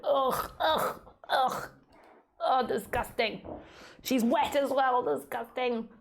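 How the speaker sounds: high, whiny mock disgust at a wet dog; mocking a dog hater. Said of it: "annoy all you dog haters out there".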